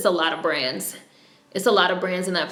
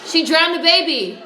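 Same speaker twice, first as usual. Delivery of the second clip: higher and louder, still reeling; stunned; 8 semitones higher, 5 dB louder than usual. Real, not acted. Telling viewers the twist, high stunned voice. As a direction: say it in real disbelief. Shocked while watching a show.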